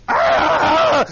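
sudden loud, high scream; demon-possessed boy's scream. Acted. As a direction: shriek like a possessed boy. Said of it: "all of a sudden this boy says".

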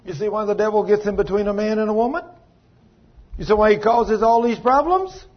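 high, loud rhetorical questions; preaching build. Real, not acted. Preacher confronting his congregation about the devil, loud fiery preaching. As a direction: hammer the congregation with each question.

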